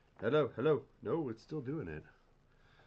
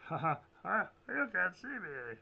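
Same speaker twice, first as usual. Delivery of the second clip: teasing singsong; 8 semitones higher than usual. Pretend. Teasing the viewers.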